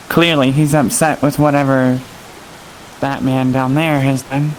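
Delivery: usual speaking voice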